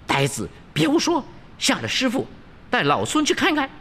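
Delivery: high, sharp-tempered Monkey King voice; Wukong scolding. A narrator's reading. Wukong rebukes the fool, high-pitched scolding. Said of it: "悟空喝道".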